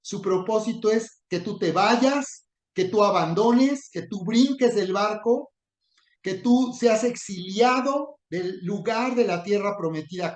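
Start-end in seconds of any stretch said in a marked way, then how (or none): none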